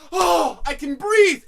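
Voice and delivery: high, loud, drawn-out gasp of joy; shouted relief. Retold or imitated. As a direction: stretch out the mock relief. Re-enacts getting breath back.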